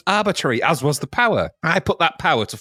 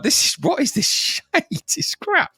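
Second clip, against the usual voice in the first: mock outrage; 13 semitones higher than usual. Cursing at the game.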